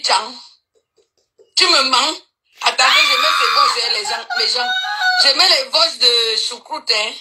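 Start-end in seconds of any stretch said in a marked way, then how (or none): none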